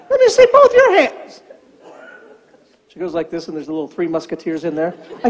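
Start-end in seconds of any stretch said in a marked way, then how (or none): 0.1-1.4 much higher, laughing through the words; laughing demand. Retold or imitated. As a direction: try to be stern but crack up.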